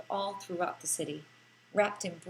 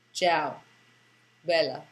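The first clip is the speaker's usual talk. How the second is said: low, louder, unsmiling Italian bouncer's greeting; grudging greeting; 5 semitones lower, 5 dB louder, slower than usual. Retold or imitated. Bouncer grudgingly greets the smiling tourist, lightly voiced. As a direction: toss it off grudgingly, face never changing. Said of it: "he grudgingly breaks the moment, face never changing".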